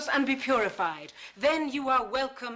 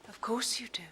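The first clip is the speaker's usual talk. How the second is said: sneering whisper; 7 dB quieter, faster than usual. Acted.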